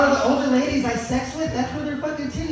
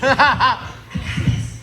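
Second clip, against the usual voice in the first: cocky; much slower than usual. Retold or imitated. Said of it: "I was like".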